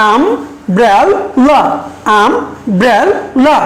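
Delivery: Umbrella in three separate beats, am, bre, la; syllable by syllable. Shown as a demonstration. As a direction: break umbrella into its syllables with a pause after each. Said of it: "তিনটা syllable".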